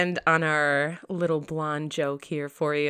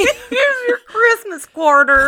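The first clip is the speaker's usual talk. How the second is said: put-on high, slow Southern accent, loud and laughing; hammed-up Southern lady, laughing; 7 dB louder, slower than usual. Echoing her cohost's Christmas gag in Southern accent. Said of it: "give it a Southern accent".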